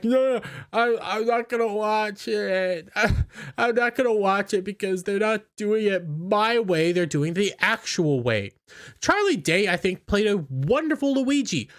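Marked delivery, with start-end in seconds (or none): 0.0-8.5 higher, whiny put-on voice of a sulking actor; mock him as a petulant star refusing to play along; mocking Leguizamo. Mocking John Leguizamo's refusal in a whiny put-on voice. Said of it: "So now he's like".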